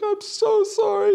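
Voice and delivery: mock a player breaking down in tears; imitated crying. Player apologizing to his caddy, imitated tearful wail. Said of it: "He was crying".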